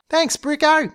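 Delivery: high-pitched flirty thanks. A narrator's reading. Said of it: "Kazza flirted as she raced off".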